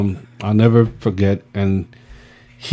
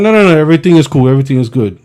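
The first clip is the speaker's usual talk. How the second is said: fast, higher nervous rush; hurried denial; 3 semitones higher, 4 dB louder, much faster than usual. Retold or imitated. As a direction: rush to cover it up. Hurried reassurance to Freddie.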